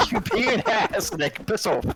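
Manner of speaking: high laughing shout; laughing yell. Real, not acted. Playful fight, laughing.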